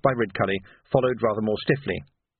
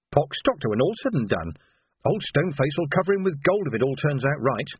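higher, swooping servant chatter; gossiping household staff; 3 semitones higher than usual. Acted. Household staff relaying doctor's orders overheard, indignant gossip.

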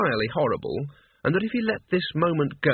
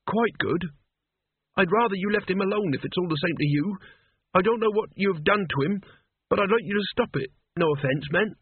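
higher-pitched voice for a worried son; earnest boy Mort; 6 semitones higher than usual. Acted. Boy asking Death to spare his father, earnest and higher. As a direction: plead politely in a higher young voice.

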